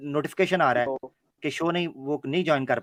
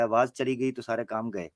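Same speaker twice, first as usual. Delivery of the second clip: ordinary talk, nothing marked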